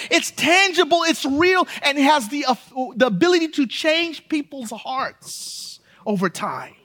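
high, loud, driving sermon; full-force preaching. Acted. Pastor preaching hard to his congregation about costly love. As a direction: preach it out to the back row.

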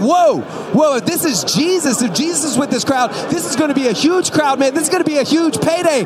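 high, shouted excitement; very loud, eager and amazed; thrilled realisation. Acted. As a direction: preach it as a beggar's astonished outburst. Blind beggar realises Jesus is coming.

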